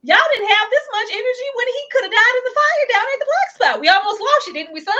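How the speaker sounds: mock the overbearing parents; mocking indignant quote. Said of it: "I was like".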